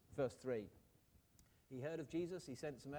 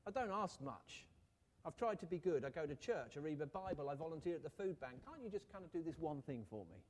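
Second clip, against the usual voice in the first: hammed-up self-justifying plea to God; mock bargaining worshipper trying to earn a favor; bargaining prayer; 3 semitones higher, 4 dB louder than usual. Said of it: "Are our prayers sometimes a bit like that?".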